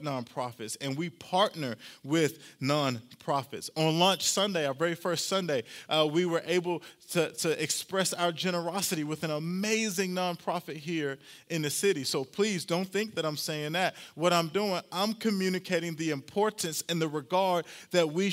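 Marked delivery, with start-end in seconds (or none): none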